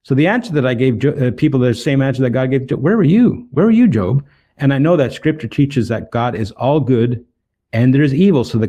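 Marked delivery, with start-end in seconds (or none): 2.8-4.2 loud, much higher challenge; God's rebuke. Acted. God rebuking suffering Job, loud and high. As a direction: fire the question at him like God.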